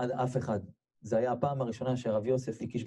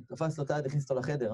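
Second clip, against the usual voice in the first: usual speaking voice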